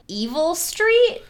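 high, slow mock menace; mock ominous. Real, not acted. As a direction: spook it up jokingly. Joking mock name.

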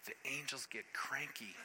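quiet, near-whispered punchline; hushed warning. Real, not acted. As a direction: drop to a hush, as an ominous warning. Hushed punchline, then repeated louder.

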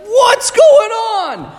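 high, drawn-out bewildered cry. Retold or imitated. Women at the empty tomb, high and drawn-out in confusion. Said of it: "And they're like".

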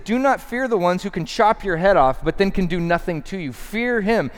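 high-pitched, emphatic warning; pointed. Acted. Jesus teaching whom to fear, pointed and emphatic. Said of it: "I love how pointed he is".

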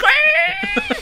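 a very high squealing laugh; shrieking laugh. Real, not acted. Laughing at scaring himself with the wipers, shrieking laugh. Shriek at his own scare.

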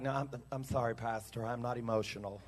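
low, soft mock apology; hushed, monotone mock reluctance; mimicked unemotional churchgoer. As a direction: imitate a reserved person excusing himself. Mimics reserved churchgoers excusing themselves.

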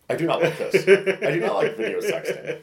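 high, laughing protest; mock-squeamish complaint; acted discomfort. Retelling his discomfort while video sexting.

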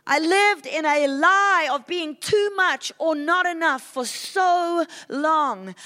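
ordinary talk

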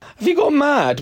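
high, scandalised disbelief; incredulous. Real, not acted. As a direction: pitch it up as if they've lost their mind. Incredulous at co-host's verdict.

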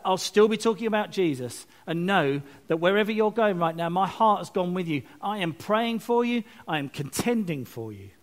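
ordinary delivery

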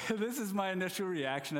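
chuckling through the words; amused, laughing as he tells it. Real, not acted. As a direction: laugh while you say it. Laughing at his own story.

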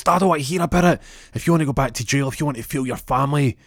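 fast, high-pitched berating boss; acted angry outburst. Boss berates employee in story.

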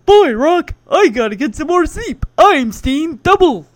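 loud, goofy cartoon moose impression; Bullwinkle. Podcaster mimicking Joey's comedy routine, loud Bullwinkle voice. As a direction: boom it out in a silly cartoon voice. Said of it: "Joey comes in to do his Bullwinkle routine".

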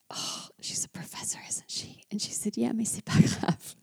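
hushed, conspiratorial exchange retold; near-whispered back and forth; hushed aside. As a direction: whisper it as if she might walk back in. Retold aside while woman out.

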